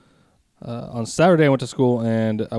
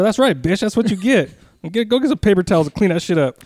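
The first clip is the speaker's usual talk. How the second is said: mock taunt; 7 semitones higher than usual. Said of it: "And I go".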